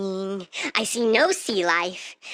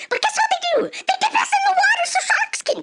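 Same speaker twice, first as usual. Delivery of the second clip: very high-pitched screaming rant; outraged shriek; 4 dB louder than usual. Acted. Storyteller dipped among sharks, outraged shriek. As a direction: shriek it in hammed-up horror.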